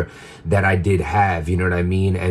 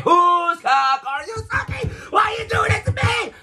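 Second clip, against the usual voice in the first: jealous outburst. Retold or imitated. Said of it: "I'm like".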